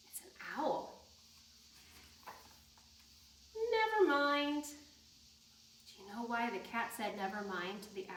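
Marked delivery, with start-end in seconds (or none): 0.1-1.1 soft secretive whisper; whispered reveal to the children. Acted. Reveals the owl on the page. 3.6-4.7 cat saying never mind. Acted. Cat's line in the story.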